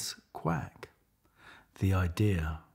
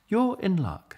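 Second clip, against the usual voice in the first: bright higher duck, eager to help; 11 semitones higher, 4 dB louder than usual. Acted. Poetic duck offers Leonard help, higher duck voice. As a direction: pitch it up as the duck. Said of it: "said Marianne".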